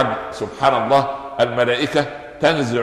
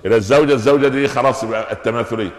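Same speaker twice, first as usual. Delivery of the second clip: normal talking voice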